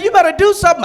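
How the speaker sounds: put-on a girlfriend pushing him to act; mock demanding girlfriend.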